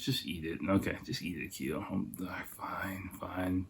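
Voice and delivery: whispered to himself; whispering. Real, not acted. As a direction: whisper it under your breath. Psyching himself up quietly.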